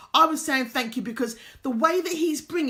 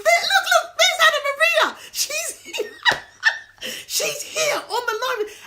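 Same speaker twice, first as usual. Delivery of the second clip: much higher and louder, laughing with delight; excited and laughing; 13 semitones higher, 6 dB louder than usual. Real, not acted. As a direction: burst out joyfully at a friend who appears. She spots Anna Maria joining live.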